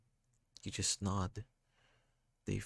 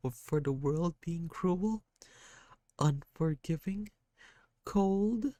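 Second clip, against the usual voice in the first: put-on crying kid; 7 semitones higher than usual. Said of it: "They just cry".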